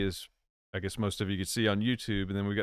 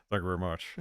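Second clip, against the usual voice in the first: lower, quick catchphrase impression; 4 semitones lower, 3 dB quieter, faster than usual. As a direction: do the singer's famous thank-you. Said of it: "Somebody else said I sound like [name]".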